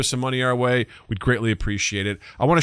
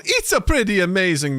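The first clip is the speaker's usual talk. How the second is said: lightly mock Italian accent; playful Italian accent impression; Olive Garden impression; 7 semitones higher than usual. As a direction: put on an Italian restaurant-ad accent. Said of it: "Spot on Olive Garden impression".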